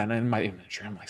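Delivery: usual speaking voice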